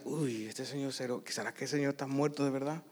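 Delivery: pensamiento en voz muy baja; duda para sí. Retold or imitated. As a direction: dudar por lo bajo, casi sin voz. Retold worried thought to himself. (duda inquieta, muy bajito)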